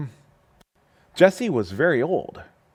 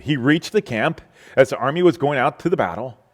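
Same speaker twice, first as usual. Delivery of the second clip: ordinary talk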